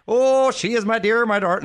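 high, hammed-up Irish lilt; Irish accent. Imitating Darby O'Gill for the wife, high Irish accent. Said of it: "that was my Irish accent".

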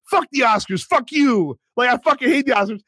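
high, punchy imitation of a sweary outburst; retold defiant rant. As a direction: act out his defiant rant. Retelling a sweary on-camera rant.

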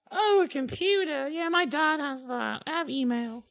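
put-on high-pitched girl character; chatty schoolgirl. Schoolgirl saying her dad has a computer, high and chatty. Voices Lucy hearing about computers.

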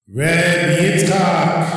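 slow sung call from above; heavenly voice. Retold or imitated. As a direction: intone the name like a voice from heaven. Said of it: "I just heard a voice".

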